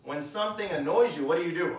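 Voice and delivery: ordinary talk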